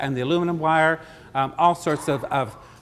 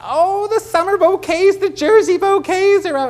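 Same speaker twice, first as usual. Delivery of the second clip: much higher, louder, slow thrilled squeal; excited squeal; 9 dB louder than usual. Retold or imitated. Florist imitating Dot's excited squeal over bouquets. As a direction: gush like a thrilled friend. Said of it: "she said".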